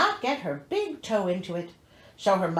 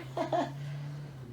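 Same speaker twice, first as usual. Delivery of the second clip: quiet laugh; 9 semitones lower, 10 dB quieter than usual. Real, not acted. Laugh at repeated line.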